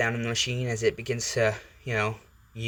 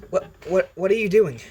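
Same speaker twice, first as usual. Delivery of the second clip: higher, louder, stammered alarm; Izuku; 8 semitones higher, 6 dB louder than usual. Acted. Boy feels the symbiote crawling on him, alarmed. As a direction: trip over the first word in mock panic. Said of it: "He, he goes".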